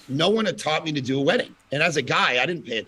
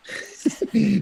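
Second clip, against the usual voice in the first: a short amused laugh; light laugh; 10 semitones higher than usual. Real, not acted. Amused by his event's name, a light laugh. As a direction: chuckle at the plain name.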